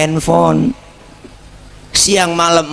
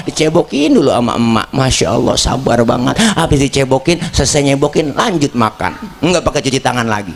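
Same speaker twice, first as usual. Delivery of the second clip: ordinary delivery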